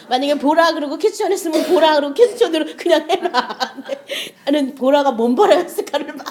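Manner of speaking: giggling through the words; real amused laughter in the voice; laughing while telling. Real, not acted. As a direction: tell it while cracking up. Laughs while telling about daughter.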